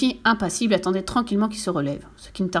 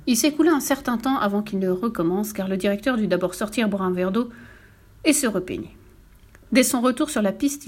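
plain reading voice

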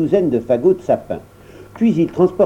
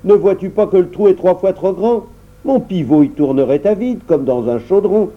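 higher, tart reproach; the dwarf points out the mistake, higher and tart; sour dwarf; 6 semitones higher than usual. Acted. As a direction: keep scolding, sour and high. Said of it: "répondit aigrement le nain".

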